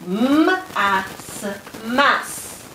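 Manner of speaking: M-A-S sound by sound, then "mas" as one syllable; sounding out and blending. Shown as a demonstration. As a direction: stretch the m, pause between sounds in "m-a-s", then say "mas". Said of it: "blend the syllables together".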